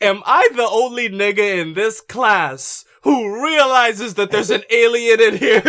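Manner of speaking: hammed-up shout, dragging the words; exasperated yell. Dib quoted yelling in class.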